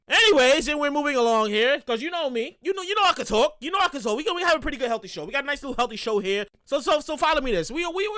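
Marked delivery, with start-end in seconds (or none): none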